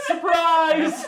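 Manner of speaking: high-pitched, playful surprise call; Barbie's surprise. Acted. Barbie arrives with a plate of shrimp, calling high. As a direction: sing out high with a laugh near.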